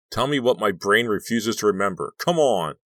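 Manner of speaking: desperate demand. A narrator's reading. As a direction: push him hard for the truth. Son shaking father, demanding.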